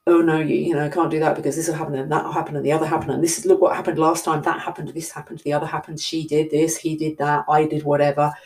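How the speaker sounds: fast, rushed anxious inner voice; anxious rambling. Retold or imitated. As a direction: tumble the worries out in a hurry. Anxious inner voice listing worries.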